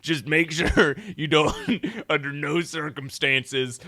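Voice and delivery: a hint of the weird cowboy again; gruff cowboy voice. Mock cowboy speech continued.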